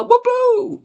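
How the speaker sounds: high-pitched playful whoop-oo; celebratory whoop. Real, not acted. Host celebrating magic time with his live chat, excited whoop.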